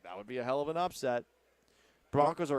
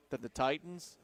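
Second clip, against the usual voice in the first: ordinary talk, nothing marked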